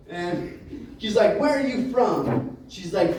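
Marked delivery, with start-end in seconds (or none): 1.4-2.6 high, drawn-out challenge; indignant waiter's question; heated demand. Retold or imitated. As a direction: ask it as an exasperated accusation.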